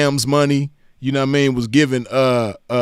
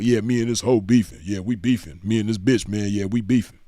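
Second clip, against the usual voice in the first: slightly hammed-up low, softer boasting voice; mock pimp talk; 5 semitones lower, 5 dB quieter than usual. Host mocking pimps bragging online, low mock pimp talk. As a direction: lightly imitate a pimp grumbling, pitched down. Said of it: "this what this shit look like".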